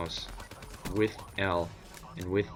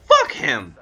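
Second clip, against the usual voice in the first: loud, raised-voice curse; angry outburst; 5 semitones higher, 11 dB louder than usual. Real, not acted. Storyteller dismissing the friend who chose the girl, angry shout. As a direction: spit it out loudly to close the rant.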